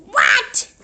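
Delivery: high, loud questioning call. A narrator's reading. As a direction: shout it up the stairs. Mother calling out.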